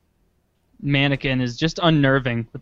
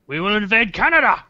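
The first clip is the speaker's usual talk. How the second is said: higher-pitched hammed-up war declaration; ISIS threat; 6 semitones higher, 3 dB louder than usual. ISIS threatening Canada in a joke script, mock menace. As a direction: announce the invasion with mock menace.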